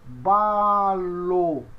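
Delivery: "balo" in two long syllables; slow, syllable-by-syllable model; pronunciation demo. Shown as a demonstration. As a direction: stretch "balo" slowly for learners. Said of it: "Essa é a pronúncia".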